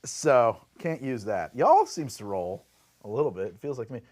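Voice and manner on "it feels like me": ordinary talk, nothing marked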